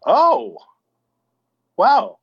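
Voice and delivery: high, loud delighted gasp; excited oh wow. Real, not acted. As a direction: burst out thrilled. Reacts to Jersey Boys.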